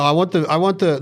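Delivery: ordinary talk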